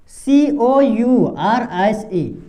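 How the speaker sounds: letter by letter, very slowly; slow spelling. Shown as a demonstration. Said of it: "क्या पढ़ेंगे इसको".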